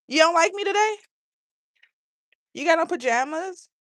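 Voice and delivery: high, loud sing-song calling. Real, not acted. Woman calling out sweetly to the neighbor boy Bentley, high sing-song.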